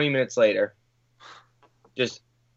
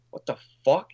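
whispered. Retold or imitated. Stunned reaction to the cops news.

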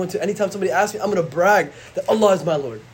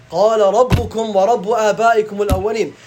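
recitation; 4 semitones higher, 6 dB louder, faster than usual. Acted. Recited Quran verse.

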